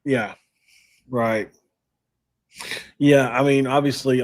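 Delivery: ordinary talk, nothing marked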